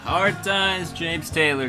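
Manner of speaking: usual speaking voice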